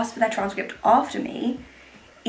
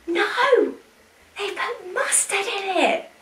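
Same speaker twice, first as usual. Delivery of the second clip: much higher, horrified cry; real outrage at the mustard, pitched way up; shocked dismay; 11 semitones higher, slower than usual. Real, not acted. Finds mustard in her burger.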